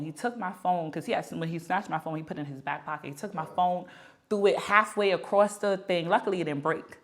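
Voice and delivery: normal talking voice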